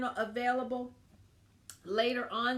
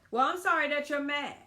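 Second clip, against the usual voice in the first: snippy fake apology, a bit louder; sarcastic non-apology; 4 semitones higher, 5 dB louder than usual. To her husband, the wrong way to apologize, snippy. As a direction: toss off a grudging sorry. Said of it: "I used to say".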